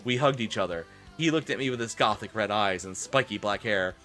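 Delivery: normal talking voice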